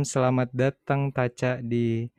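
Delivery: ordinary talk, nothing marked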